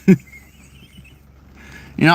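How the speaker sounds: ordinary talk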